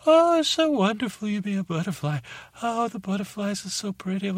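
high, airy mock swooning; mocking gusher. Butterfly mimicking admiring humans, mock-dreamy falsetto. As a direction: gush in a sugary falsetto, making fun.

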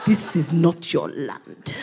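slow, low ominous voice; a land rejecting her, slow; ominous voice of Nigeria. Retold or imitated. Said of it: "I could just hear Nigeria going".

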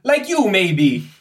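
put-on high, loud accented character voice; flustered, loud foreign scholar; excitable foreign Egyptologist, pleading. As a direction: insist eagerly in a high foreign voice. Plays Ippolito, the foreign Egyptologist.

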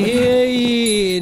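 loud echoing call; town crier. Acted. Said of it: "town crier".

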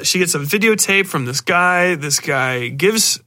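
usual speaking voice

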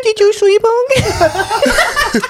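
put-on slow, high cooing baby talk; cutesy baby voice. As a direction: drag it out sweetly, laughing.

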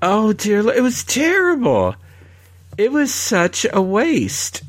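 high, exasperated rant; scathing critique. Real, not acted. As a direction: pour scorn on it. Scathing rant about the tram tour.

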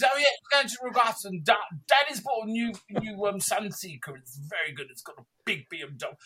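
a hint of a posh boy, fast then fading; lightly mocking rich boys bragging; posh lad. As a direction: rich kid boasting about daddy's boat, lightly voiced. Posh lads voiced again.

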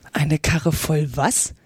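low, slow wondering to oneself; puzzled thought. A narrator's reading. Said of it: "dachte Alice". (nachdenklich, tief und langsam gefragt)

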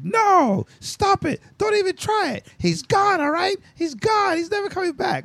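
very high, mocking whiny refusal; high falsetto mocking Iris's bitter refusal. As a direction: squeak out her stubborn no in falsetto. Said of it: "How you gonna be like".

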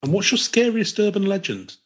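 high-pitched letter-reading voice; put-on listener voice. Host reading Tammy's question to Chris, high put-on voice.

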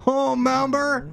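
high, gushing mock-fan squeal; nostalgic viewer. Mocking nostalgia viewers reminiscing, gushing mock-fan voice. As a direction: gush in a high, loud put-on voice. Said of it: "sit there for a half an hour and go".

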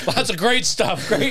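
high, loud laughing sarcasm; laughing sarcastic. Acted. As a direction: laugh it out mockingly. Sarcastic praise amid laughter.

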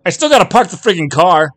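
high, loud annoyed shout; husband yelling back across the entrance. Acted. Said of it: "he shouted back at her".

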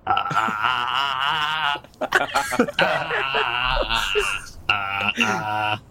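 mock vocal warm-up "ah"s. Player warming up for an accent, comic vocal exercises. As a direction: run through silly open "ah" exercises. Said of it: "I gotta do my warm-ups".

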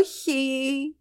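high, drawn-out soft wail; muted anguished scream. A narrator's reading. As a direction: stretch out a distant, agonized cry of refusal. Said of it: "ουρλιάζοντας".

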